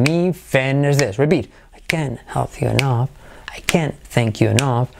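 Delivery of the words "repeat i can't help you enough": ordinary talk, nothing marked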